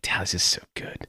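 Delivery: breathy near-whisper of admiration; hushed awe. Retold or imitated. Fan to friend, whispered awe. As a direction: whisper it like you just saw something great. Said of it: "And you're like".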